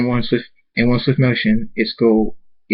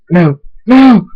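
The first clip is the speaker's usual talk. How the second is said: loud cries rising higher; panicked cries; 10 semitones higher, 9 dB louder than usual. A narrator's reading. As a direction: cry out in mounting panic. Character attacked, crying out in story.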